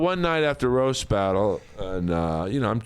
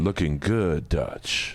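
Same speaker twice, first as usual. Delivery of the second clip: put-on much lower, fading gruff voice of an old army colonel; 7 semitones lower, much slower than usual. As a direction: growl it like a seasoned colonel greeting Dutch. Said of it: "old colonel at the begin".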